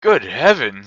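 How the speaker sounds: loud astonished cry; amazed. A narrator's reading. Scrooge sees his childhood home, astonished cry. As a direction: gasp at the old home. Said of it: "clasping his hands together".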